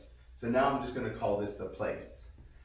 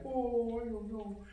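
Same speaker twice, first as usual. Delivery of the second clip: dog's voice; 12 semitones higher, 4 dB quieter, slower than usual. Pretend. Voicing the dog.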